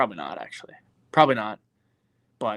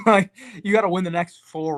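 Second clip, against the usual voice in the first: laughing; 6 semitones higher, 5 dB louder than usual. Real, not acted. Laughing at fighter's hopeless odds.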